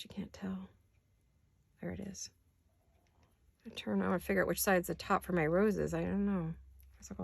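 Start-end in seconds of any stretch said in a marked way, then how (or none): 0.0-2.3 breathy whisper under her breath; soft aside whispered to no one; whisper to self. Real, not acted. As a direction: murmur it to yourself while fiddling with lace. Muttering to herself while crafting.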